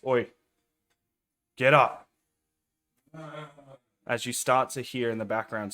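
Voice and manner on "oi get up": put-on loud rough barkeep waking a drunk; gruff bartender shout. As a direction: bark it at the sleeper slumped on the bar. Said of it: "as the bartender goes".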